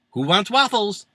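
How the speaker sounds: high, mock vendor's call. Catering cart vendor offering waffles, high call-out. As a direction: call it out to hungry customers.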